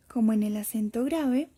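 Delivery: normal talking voice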